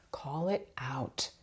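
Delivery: slow, breathy whisper. Shown as a demonstration. Speaker telling viewers to call it out, whispered. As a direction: whisper it, just for yourself. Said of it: "Even if you're not shouting it out loud".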